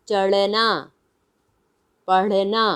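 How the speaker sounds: "चढ़ना" and "पढ़ना" with flap and slight schwa after it; flapped ढ़. Shown as a demonstration. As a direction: flick the tongue before "ना" in "चढ़ना", "पढ़ना". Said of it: "हम बोल रहे हैं".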